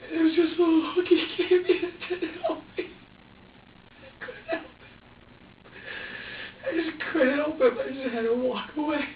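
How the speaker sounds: fighting back tears while telling it; crying. Real, not acted. Father crying as he tells the interviewer, breaking voice. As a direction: voice breaking, high and tearful.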